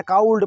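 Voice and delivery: "काउल्ड" with a diphthong and voiced L; L sounded. Shown as a demonstration. Teacher mocks a misreading, diphthong and L in "काउल्ड". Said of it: "नहीं पढ़ते हैं".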